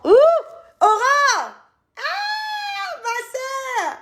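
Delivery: high, delighted shout of her name; a real burst of delighted calling; excited greeting. Real, not acted. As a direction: call out to her sister with joy. Greeting her big sister on stream.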